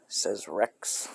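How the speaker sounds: ordinary talk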